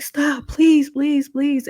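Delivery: desperate begging. Retold or imitated. Held-down woman begs attacker, desperate high plea. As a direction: cry out for him to stop.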